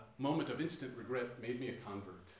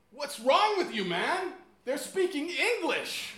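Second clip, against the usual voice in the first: much higher, much louder outraged yell; an irate local shouting down a tourist, slow and furious; angry shout; 13 semitones higher, 11 dB louder, slower than usual. Retold or imitated. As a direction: bellow it at the clueless tourist, slowing right down on English. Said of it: "he got really loud and said".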